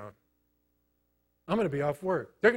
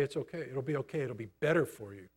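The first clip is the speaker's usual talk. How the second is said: soft, lowered comforting voice; gentle reassurance; 4 semitones lower, 8 dB quieter, faster than usual. Retold or imitated. A fellow patient comforting a newcomer, softly. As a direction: ease someone's worry quietly. Someone who's been through it reassuring.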